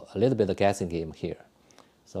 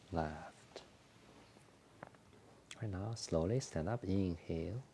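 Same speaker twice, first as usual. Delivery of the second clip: soft, calm breathing cues with whispered exhales; guided exercise instructions; 7 dB quieter, slower than usual. Acted. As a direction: talk the class gently through each movement. Leads a qigong breathing routine.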